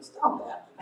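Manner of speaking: lightly acted, hushed telling-off; mild pet scolding. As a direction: softly tell off the dog.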